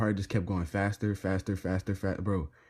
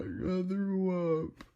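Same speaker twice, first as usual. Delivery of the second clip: sheepish; 11 semitones higher, much slower than usual. Retold or imitated. Sheepish reply to angry girlfriend.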